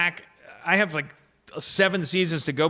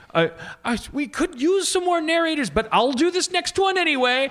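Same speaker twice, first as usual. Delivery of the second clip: apologetic host; 9 semitones higher than usual. Retold or imitated. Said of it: "I go".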